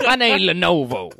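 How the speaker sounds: laughing mock Cajun.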